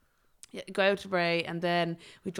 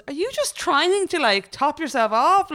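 much higher, slow, laughing mock question; teasing friend; 9 semitones higher, 6 dB louder, slower than usual. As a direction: tease her through a laugh, high and drawn out. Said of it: "she goes".

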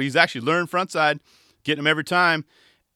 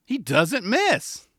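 higher, very slow confident call; higher very slow mock commentator; quoting commentator; 7 semitones higher, much slower than usual. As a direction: stretch every word like a smug caller. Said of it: "[name] decides to chime in".

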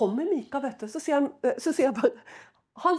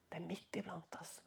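"Det er midt iblant oss" whispered, much softer; 6 semitones lower, 18 dB quieter than usual. Shown as a demonstration. Preacher repeating his warning to the congregation as a whisper.

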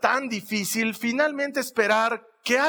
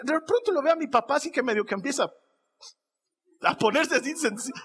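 normal talking voice

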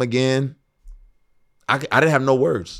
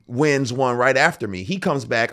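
ordinary delivery

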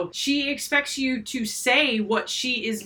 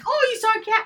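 eager mom; 13 semitones higher, much faster than usual. Retold or imitated. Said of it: "And I'm like".

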